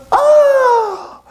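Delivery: very high monkey wail; hammed-up squeal of dismay. Monkey hit the ground with a thud, squealed dismay.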